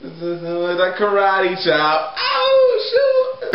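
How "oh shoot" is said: squealing high with excitement; excited squeal. Acted. Guy hears his favourite song, sudden high squeal. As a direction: squeal like a kid who loves the song.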